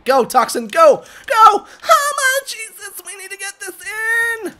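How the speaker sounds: high, loud yelling to a teammate; frantic urging. Real, not acted. Player urging Toxin to score, frantic shout. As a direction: build from shouts into a high strained plea.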